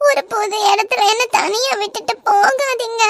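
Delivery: tearful plea. Acted. Wife on the phone begging her husband, crying. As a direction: beg him through tears not to leave. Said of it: "ஏய், ஏன்டி அதுக்கு அழுற?".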